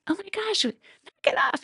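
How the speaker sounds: shrill high yelp, half laughing it off; high joking mock yell of fright; joking startled yell. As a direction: squeal it out like a startled friend who is only half mad. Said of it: "yelled out like it was my fight thing, but more joking".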